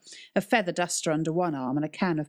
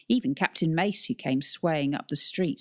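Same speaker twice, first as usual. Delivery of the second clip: plain reading voice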